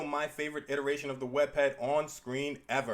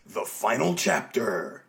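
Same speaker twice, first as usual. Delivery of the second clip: low, slow, loud mock announcer; dramatic title voice; 5 semitones lower, 5 dB louder, slower than usual. Host announcing the comic's title, low and loud.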